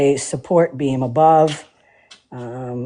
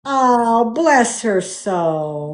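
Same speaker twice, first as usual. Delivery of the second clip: folksy Southern pity; 5 semitones higher, much slower than usual. Retold or imitated. Mock Southern sympathy.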